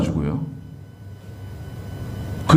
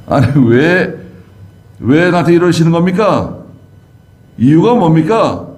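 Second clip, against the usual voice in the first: higher-pitched, indignant challenge; defiant protest; 6 semitones higher than usual. Acted. Disciplined believer squares up to God, defiant. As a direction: talk back, demanding an explanation. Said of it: "반항하고 말이죠".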